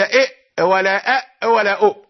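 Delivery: إئ, أ and أؤ each shut by a glottal stop; short caught sounds for إئ, أ, أؤ; forbidden doubled hamzas. Shown as a demonstration. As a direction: choke off each short vowel in إئ, أ, أؤ. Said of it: "هذه الأصوات ليست موجودة".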